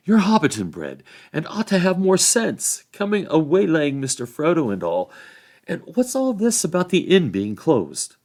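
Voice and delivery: high, loud country-hobbit telling-off; scolding Sam. A narrator's reading. Sam scolding Robin over the closed inn, indignant. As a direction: take him indignantly to task.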